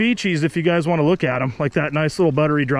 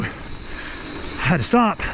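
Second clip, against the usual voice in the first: out of breath; 4 dB quieter, much slower than usual. Real, not acted. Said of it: "And my legs were burning".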